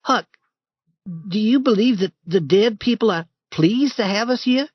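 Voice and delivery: put-on high boyish voice, uneasy; a scared boy asking in the dark; young Tom. Tom asking Huck at the new grave, high nervous boy.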